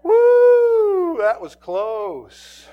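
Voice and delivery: loud high whoop, then slow relieved words. Retold or imitated. Officer to backup after the arrest, loud relieved whoop. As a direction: let all the fear out in one whoop.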